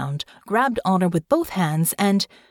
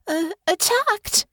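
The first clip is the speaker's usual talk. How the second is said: higher, slow, frightened stammer; frightened stammer, much higher; 12 semitones higher, slower than usual. A narrator's reading. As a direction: stumble over it in shock. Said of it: "she stammered".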